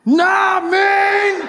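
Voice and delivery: "Nah mean" shouted high like a battle cry; epic nah mean. Pretend. Comic turning the slang epic, shouted. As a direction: keep the movie-hero shout going. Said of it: "This is Sparta!".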